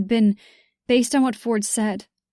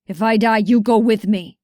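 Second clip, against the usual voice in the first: snarled threat; 5 dB louder than usual. A narrator's reading. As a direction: snarl it through the struggle. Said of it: "he snarled".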